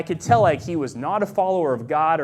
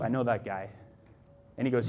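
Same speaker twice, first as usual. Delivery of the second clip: low, hushed retold reply; understated, ominous recognition; grave warning; 6 semitones lower, 6 dB quieter than usual. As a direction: quote him softly and low.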